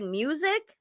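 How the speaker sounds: "Music?" lifted at the end as a question; imitated questioning tone. Showing how she said it, "Music?" with questioning rise. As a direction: ask "Music?" with a rising, unsure tune. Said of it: "how she said it like a question".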